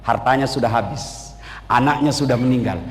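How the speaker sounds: a hint of a solemn God; God's voice. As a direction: speak down calmly as God. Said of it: "Kata Allah".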